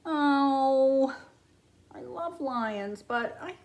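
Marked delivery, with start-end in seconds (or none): none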